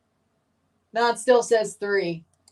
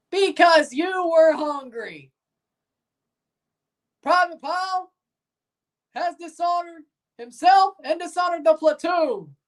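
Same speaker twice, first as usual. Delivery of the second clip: drill sergeant; 8 semitones higher than usual. Pretend. Drill sergeant announcing punishment to the platoon, high and stern. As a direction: hand down the punishment to the whole platoon.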